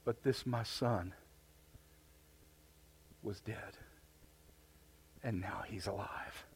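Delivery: softly, gently reassuring the elder son; a father quietly comforting his son; the father answering his jealous son in a hushed, tender voice. Retold or imitated. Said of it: "He said".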